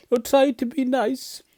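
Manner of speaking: high-pitched scared pleading; frightened whimper. Acted. Frightened lion begs mouse to be nice, high whimper. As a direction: whimper it high, begging for kindness. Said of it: "he whimpered".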